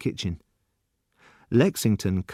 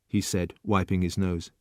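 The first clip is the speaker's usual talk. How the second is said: ordinary delivery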